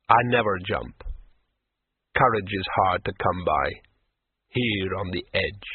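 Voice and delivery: a hint of a melancholy troll. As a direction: let the troll sound weary. Troll's sad admission.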